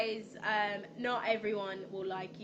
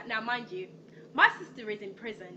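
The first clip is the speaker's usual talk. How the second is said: normal talking voice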